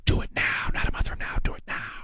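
whispered, breathy mock demand. Host mocks the president's whispered demand to gas stations. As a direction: hiss it like a faint old man. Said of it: "he demanded the gas stations".

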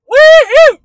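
very high, loud hillbilly whoop; whooping holler. Acted. Hillbilly recalling pig wrestling with his sister, whooping holler. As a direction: let out a long, ringing woo-hoo.

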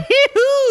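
high cackling laugh impression; Brad Pitt laugh. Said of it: "Brad Pitt laughing".

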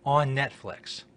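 ordinary talk, nothing marked

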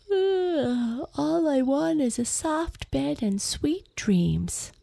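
high sleepy yawn. Acted. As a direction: yawn through the words. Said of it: "Astrid yawned".

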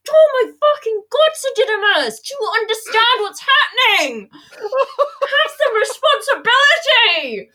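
loud, high, laughing exasperation; exasperated yell. Retold or imitated. The speaker yelling at Sir Didymus, high exasperated yell. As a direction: scold him through laughter. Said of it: "I was like".

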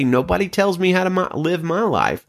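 lightly voiced defiant person; defiant mock voice. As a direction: toss it off like a stubborn know-it-all. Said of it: "They say".

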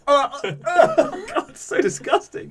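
high, slow, laughing gag; laughing disgust. Pretend. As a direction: squeal through laughter at the taste. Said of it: "it's like".

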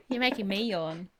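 laughing while talking; words broken by a laugh. Real, not acted. Laughing at shared yawning.

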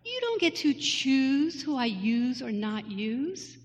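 slow, hushed and firm, like a gentle rebuke from above; God speaking. Retold or imitated. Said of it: "God's telling me this".

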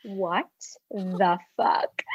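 low, quiet, drawn-out mock disbelief; mock stunned disbelief. Roommate eyeing his outfit, mock stunned disbelief. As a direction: imitate a friend's hushed, incredulous reaction.

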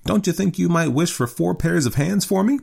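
put-on high-pitched old wife's voice; light teasing in a high woman's voice; old lady. A narrator's reading.

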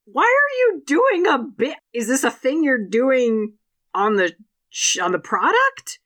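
high, slow incredulous questioning; baffled disbelief. Retold or imitated. Host asking the ad why Dean Ambrose does a bit, in slow baffled disbelief. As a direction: ask it slowly as if nothing makes sense. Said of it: "And I was like".

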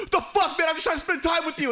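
high, loud mock whine of a hurt friend; a high, loud, offended friend; imitating hurt roommate. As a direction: squeal it like a wounded buddy. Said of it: "he goes".

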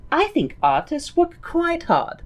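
put-on light German accent. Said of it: "but in a German accent".

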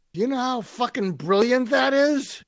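mock New Yorker shouting his delight; rough gruff New Yorker. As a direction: bellow it like an excited tough New Yorker. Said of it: "a rough, gruff New Yorker".

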